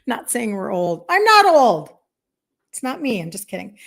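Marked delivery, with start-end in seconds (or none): 1.1-1.9 much higher and louder, playfully protesting; a hammed-up "I'm not old!"; mock-indignant denial. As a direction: shoot it up in fake outrage. Joking denial about being old.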